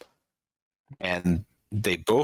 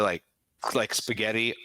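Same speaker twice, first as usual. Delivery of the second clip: ordinary talk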